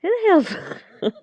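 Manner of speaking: high-pitched, drawn-out puzzled question; mock-baffled, slow and swooping high; retold bafflement. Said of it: "was like".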